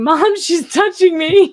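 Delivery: put-on high, loud, slow kid's whine with a laugh; whiny tattling little sibling. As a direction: whine like a kid telling on a sibling. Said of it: "in the back seat yelling".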